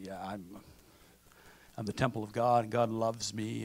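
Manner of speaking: a hint of a boastful show-off, said low and soft; cocky. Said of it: "you're cocky and you say".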